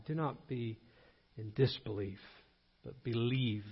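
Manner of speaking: hushed, low and solemn; soft, low, measured plea; gentle appeal. Acted. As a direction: drop to a near-murmur, tenderly urging faith. Voicing Jesus to doubting Thomas.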